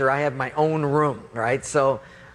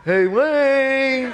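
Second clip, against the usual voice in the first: high, loud, drawn-out call; Mike; 10 semitones higher, 5 dB louder than usual. Retold or imitated. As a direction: imitate a pestering friend hollering through the door. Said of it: "And it's Mike".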